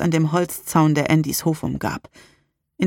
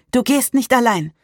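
higher, firm voice; undaunted; 7 semitones higher than usual. A narrator's reading. Salem refuses to let Rain go alone, undaunted. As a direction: insist without fear. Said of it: "sagte sie unerschrocken".

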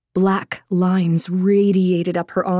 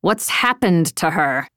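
Quindor; 3 semitones lower than usual. A narrator's reading. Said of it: "Quindor's voice".

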